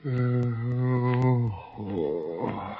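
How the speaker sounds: drowsy groans, coming round slowly; groggy waking. Acted. Prisoner waking on the table, groggy and dazed. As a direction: surface from a drugged sleep, dazed. Dunlap waking from drugged sleep.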